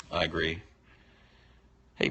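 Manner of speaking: ordinary delivery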